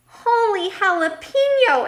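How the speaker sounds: high, loud storybook exclamation; excited exclamation. A narrator's reading. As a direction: burst out like an excitable little character. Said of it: "exclaimed Skippy John Jones".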